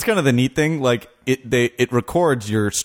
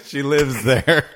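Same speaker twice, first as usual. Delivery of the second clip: laughing; 4 semitones higher, slower than usual. Real, not acted. Laughing through protest.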